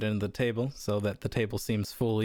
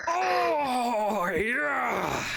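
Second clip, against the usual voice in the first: mock pained groan; 13 semitones higher than usual. NPC smacked on the back.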